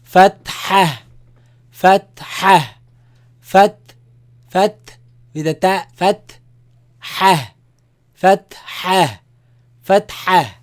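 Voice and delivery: فتحة said loud and high, with a strong breathy ح; teacher pronunciation drill. Shown as a demonstration. Teacher drilling students on the vowel name, loud and emphatic. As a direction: project فتحة clearly, stressing the ح. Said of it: "If you pronounce it, say".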